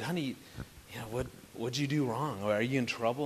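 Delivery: soft, tender fatherly questions; gentle. Retold or imitated. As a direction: comfort her quietly, like a dad. Dad comforting crying daughter.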